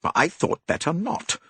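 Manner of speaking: slow, curt refusal; stiff, clipped reply; stiffly. A narrator's reading. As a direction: say it tight and cool. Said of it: "said her cousin stiffly".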